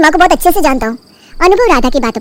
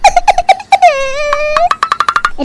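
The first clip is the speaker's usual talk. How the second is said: 1 fake sob; 11 semitones higher than usual. Bahu's fake sob-laugh.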